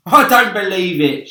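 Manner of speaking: loud, high defiant shout. Acted. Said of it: "shouted the centipede".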